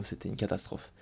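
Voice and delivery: unvoiced muttered verdict; whispered aside. Real, not acted. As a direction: drop to a whisper in disgust. Aside after complaining about Cesaro. (chuchoté, dégoûté)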